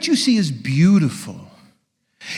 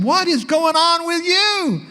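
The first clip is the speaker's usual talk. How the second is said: put-on much higher, slow girl's voice; curious stepdaughter; 13 semitones higher, slower than usual. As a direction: ask it wide-eyed as the girl. Stepdaughters asking, quoted inside quote.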